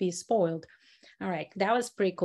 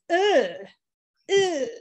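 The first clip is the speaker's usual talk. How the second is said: high, loud groan of disgust; disgusted ugh; 7 semitones higher, 4 dB louder than usual. Shown as a demonstration. Teacher voicing the students' disgust at a fly. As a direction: recoil with a sick ugh. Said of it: "great sound of disgust".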